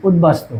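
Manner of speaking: easy, relaxed উদ্বাস্তু as utbastu; natural pronunciation. Shown as a demonstration. Teacher showing students the natural way to say উদ্বাস্তু. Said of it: "দেখো কি বলছি".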